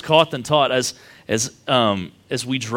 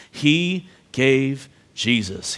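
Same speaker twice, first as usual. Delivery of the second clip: each word drawn out slowly; slow emphasis; much slower than usual. Acted. Preacher stressing God's gift to the congregation. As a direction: lean on every word, very slowly.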